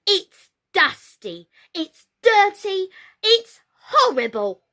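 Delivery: put-on high, loud child's wail; little Apple crying out. A narrator's reading. Little Apple wailing at the filthy doll's house, high and loud. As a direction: wail it like a small distressed child. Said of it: "cried little Apple".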